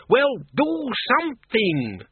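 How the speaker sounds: very high, drawn-out frantic demand; frantic cry. A narrator's reading. Doreen urging Arthur on the trolley, frantic and high. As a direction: wail it slowly, pitch right up. Screamed exchange during trolley fight.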